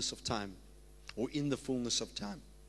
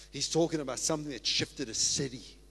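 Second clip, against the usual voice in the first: ordinary delivery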